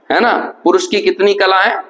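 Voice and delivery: ordinary talk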